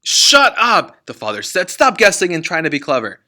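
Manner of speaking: father barking at his daughter; angry snap. Acted. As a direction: shut her down hard.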